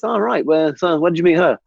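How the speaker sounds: put-on officer making small talk; infantry boss impression. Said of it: "that was a good infantry boss impression".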